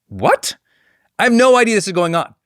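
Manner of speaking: retold disbelief, voice leaping up after the question; baffled. Consultant on a call about an unknown book, baffled. Acted bafflement at surprise phone request.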